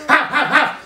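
high, loud triple bark; dog barking. Acted. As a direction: bark sharply like a watchdog. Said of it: "Já jsem štěkal". (ostrý psí štěkot)

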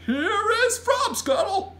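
loud, high, slow giant fanfare; triumphant drawn-out giant shout; proud cry. A narrator's reading. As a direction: present it like a rare treasure. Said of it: "he cried, holding the bottle up proud and high".

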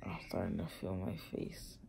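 hurting, whispering through a sore mouth; barely voiced after wisdom teeth; pained whisper. Real, not acted. Whispering in pain after surgery.

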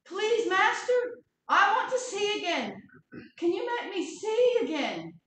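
loud, high pleading voice; pleading beggar. Acted. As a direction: beg for your sight. Blind beggar begs to see.

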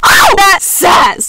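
loud, high yelp at the sass; hammed-up ouch; mock-wounded shout. Real, not acted. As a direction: cry out as if stung. Mock hurt by the insult.

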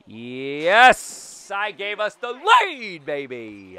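loud, high, stretched-out victory cry; triumphant shout. Real, not acted. Player celebrates taking the lead, triumphant shout.

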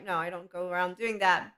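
ordinary talk, nothing marked

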